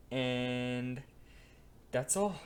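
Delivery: ordinary talk, nothing marked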